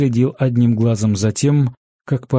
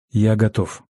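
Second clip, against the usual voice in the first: slushy sh for s. Acted. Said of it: "прошепелявил гость".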